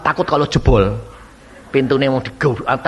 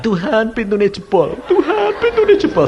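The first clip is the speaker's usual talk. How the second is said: higher, rising panic with a laugh; panicked prayer; 7 semitones higher than usual. Retold or imitated. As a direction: pray in growing panic. Frantic prayer as door breaks. (doa panik yang makin tinggi)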